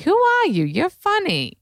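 very high-pitched old-lady impression; elderly dermatologist. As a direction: imitate an old woman bluntly sizing someone up. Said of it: "this Shirley MacLaine looking derm".

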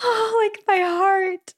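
high breathless swoon. Retold or imitated. Wife recalling husband's first love-you text, high swoon.

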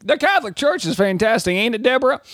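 high-pitched dumb American tourist in a hammed-up Southern accent; Southern accent of a clueless tourist group; mock Southern accent. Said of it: "they do be making that accent".

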